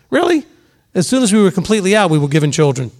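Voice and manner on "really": incredulous. Real, not acted. Incredulous retort to critics.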